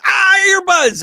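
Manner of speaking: very high, loud shout of discovery; excited shout. Retold or imitated. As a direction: blurt it out like solving charades. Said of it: "hysterical 'cause she goes".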